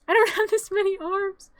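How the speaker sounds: high laughing exclamation. Real, not acted. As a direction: laugh it out, overwhelmed. Repeated while laughing.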